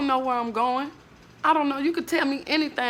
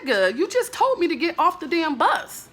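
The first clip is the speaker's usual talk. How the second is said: indignant retort; 7 semitones higher than usual. Retold or imitated. Angry retort to bus driver.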